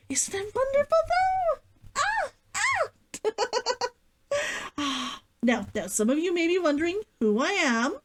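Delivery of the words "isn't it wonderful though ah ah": very high delighted squeal. Real, not acted. Delighting in her costume for viewers, high squeal.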